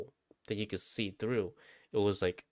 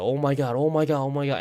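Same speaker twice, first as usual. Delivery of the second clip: loud, raised, repeated mock panic; retold panic; 6 semitones higher, 6 dB louder, faster than usual. Storyteller reliving being scared in the dream, acted panic. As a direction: re-enact rising alarm, words tumbling out in a chain. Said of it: "I was fucking scared".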